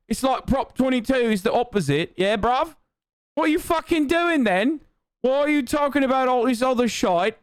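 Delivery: mock British accent. Streamer imitating British chatters demanding Uber coverage, mock British accent. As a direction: nag indignantly in a put-on British accent. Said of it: "every British person in the chat's like".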